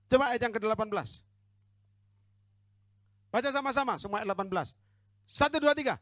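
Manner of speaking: rousing sermon shout; preaching at full force. Acted. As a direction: thunder it out from the pulpit. Preacher reads and exhorts loudly.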